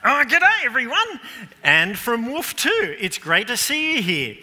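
high, loud show-host welcome; loud, high-pitched cheery entrance; excited greeting. Pretend. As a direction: burst in with a big hammed-up hello. Hammed-up greeting to church audience.

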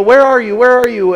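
put-on loud, high hollering for a lost child; frantic shouting. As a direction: yell it out in a panic. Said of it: "they start shouting and hollering".

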